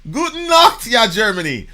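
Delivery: high, booming German call-out; hammed-up loud greeting. Host greets Germany, hammed-up shout. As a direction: bellow the German greeting to a crowd.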